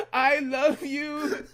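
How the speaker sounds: high, slow, hammed-up wail; mock crying. Imitating traumatized Billy in the spider web, slow mock-crying wail. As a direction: wail it drawn out, half laughing. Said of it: "Just crying".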